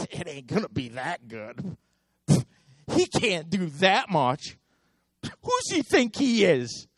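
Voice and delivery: doubtful chuckle through the words; mock sceptical snicker; scoffing laugh. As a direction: laugh it off in disbelief.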